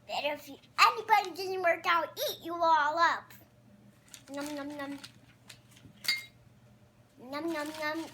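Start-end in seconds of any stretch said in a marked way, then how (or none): 0.8-3.2 loud, fast mock threat; kid's hammed-up eat-you-up growl; playful monster threat. Puppet threatens to eat others. 4.3-8.1 eating noises. Pretend. Puppet pretends to eat.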